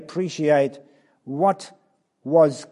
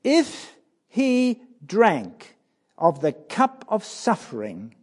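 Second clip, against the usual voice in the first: rising, louder pulpit preaching; impassioned preaching; 4 semitones higher, 5 dB louder than usual. Acted. Preacher pressing Christ's choice on the congregation, fervent. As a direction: drive the question home to the congregation.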